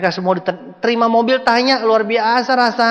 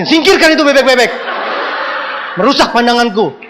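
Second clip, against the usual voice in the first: mock haughty order; 7 semitones higher, 3 dB louder than usual. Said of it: "Saya bilang sama Yasin".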